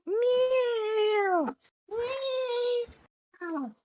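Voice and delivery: high meowing like a cat; playful cat meows; cat noises. Acted. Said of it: "It's cat noises".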